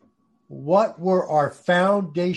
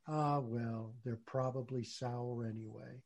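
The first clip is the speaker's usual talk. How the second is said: soft resigned shrug; 3 semitones lower, 13 dB quieter than usual. Retold or imitated. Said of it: "And we suddenly say".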